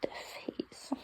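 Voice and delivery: crying whisper. Real, not acted. Sad farewell to her dog.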